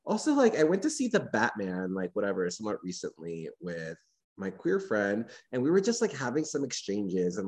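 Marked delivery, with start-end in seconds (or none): none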